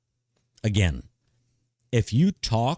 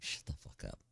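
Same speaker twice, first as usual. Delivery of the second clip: whisper; 20 dB quieter than usual. Retold or imitated. Wife's reply in retold exchange.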